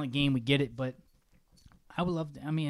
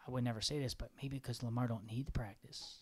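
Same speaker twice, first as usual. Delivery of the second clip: hushed secret aside, whispered; conspiratorial whisper; 2 semitones lower, 10 dB quieter than usual. Acted. Host whispers a spicy take to his co-host, whispered. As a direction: whisper it like a forbidden opinion. I would never say this.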